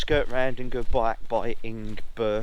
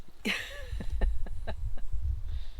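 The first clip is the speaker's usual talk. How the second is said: high laugh; 5 dB quieter than usual. Real, not acted. Laughs at being too young.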